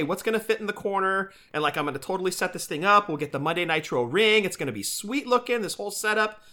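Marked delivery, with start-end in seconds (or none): none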